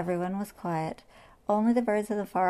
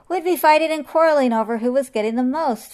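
excited anteater voice; 6 semitones higher, 8 dB louder, faster than usual. Acted.

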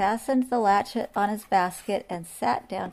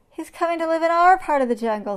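frightened peccary voice; 9 semitones higher, 7 dB louder, faster than usual. Acted.

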